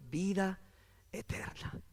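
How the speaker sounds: slow, falling away to a whisper; hushed. Real, not acted. Preacher reciting scripture to congregation, hushed. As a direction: hush it almost to nothing.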